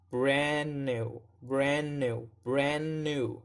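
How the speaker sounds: "brand new" with gliding A and no D; linked sound drill. Shown as a demonstration. Teacher models for learners to imitate, "brand new" joined without D. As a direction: link "brand new" on one held N. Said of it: "So try to imitate that".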